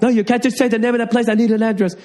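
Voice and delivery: very high, angry driver's yell; mock irate rant; imitated yelling. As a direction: cut in yelling, pitch pinned high. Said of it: "stop yelling at me".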